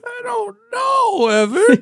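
high, slow, loud frightened cry; mock scared. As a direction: shriek like you're being hunted. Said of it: "You're scaring me".

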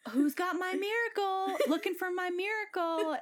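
high sing-song imitation of a pleading deadhead; playful high-pitched hippie voice; mock hippie plea. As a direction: lightly parody her calling for a miracle. Retold deadhead begging on sign.

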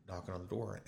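normal talking voice

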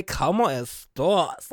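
slow phrase, long rounded 'estás'; diva catchphrase. Shown as a demonstration. Host quoting their own catchphrase, diva drawl. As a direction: stretch out 'estás' with attitude. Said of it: "when I say".

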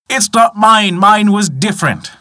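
high, insistent refusal; acted protest. A narrator's reading. Greedy man rejects silver axe, high protest.

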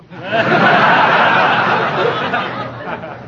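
big chuckling laugh from the uncle; hearty laugh. Acted. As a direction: laugh out loud at the joke. Uncle laughs at stink bomb.

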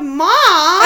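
put-on playful yelled child call; mock child yell. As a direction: yell it like a kid calling.

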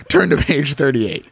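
ordinary delivery

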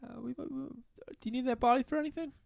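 high-pitched, hedging bartender voice; hesitant bartender. Acted.

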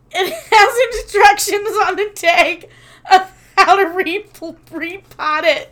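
loud, high, struggling through laughter; real laughter squeezing the words; laughing retelling. Real, not acted. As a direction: quote it while cracking up. Retelling absurd remark while laughing.

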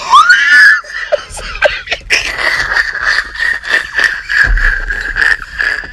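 long high shrieking laugh; howling laugh. Real, not acted. Host who should not laugh this hard, howling laugh. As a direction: let the laugh explode and run long. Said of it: "I should not be laughing this hard".

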